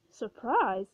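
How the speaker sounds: usual speaking voice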